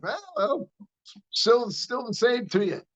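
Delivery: high, mocking, playful jab; cheeky comeback. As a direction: tease him without backing down.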